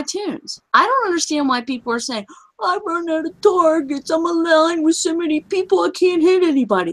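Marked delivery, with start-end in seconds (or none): 2.6-6.9 a mock complaint in a higher, put-on voice; hint of a put-upon gamer; lightly mocked complainer. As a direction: imitate a complaining player, pitched a little higher. Mimicking complaining players.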